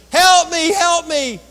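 high, loud desperate yell; falling hiker yells up for help, desperate; hollering for help. Retold or imitated. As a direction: scream up the cliff for someone to save you. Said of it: "he hollered at the top".

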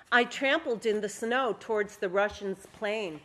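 story reading. A narrator's reading. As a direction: read the memoir scene out with energy. Reads her story aloud.

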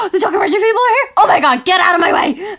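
fast laughing, rising excitement; excited gasp. Acted. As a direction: gasp and rush in, thrilled.

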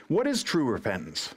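normal talking voice

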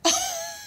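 very high-pitched short laugh; amused laugh. A narrator's reading. Prime minister laughing at the general's request, amused. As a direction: burst into a high little laugh. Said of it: "The prime minister laughed".

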